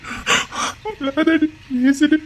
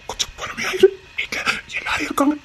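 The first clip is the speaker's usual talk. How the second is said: soft urgent whisper; 5 dB quieter than usual. Acted. Husband begging his wife for patience, whispering. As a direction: plead quietly so no one hears. Husband hushing her again.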